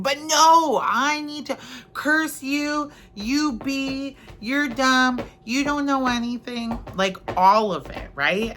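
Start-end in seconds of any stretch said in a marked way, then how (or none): 0.0-6.8 mock angry driver. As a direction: curse the flagger like a sulky kid.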